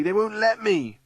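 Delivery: put-on high, anxious child's protest; frightened cry. A narrator's reading. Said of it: "cries Sally".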